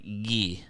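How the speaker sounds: Guy said the French way, "gee" with a hard g; French pronunciation. Retold or imitated. Classmates giving a student his French name, French pronunciation of Guy. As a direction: rhyme Guy with "key", French style. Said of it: "they were like".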